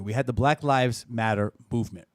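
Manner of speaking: usual speaking voice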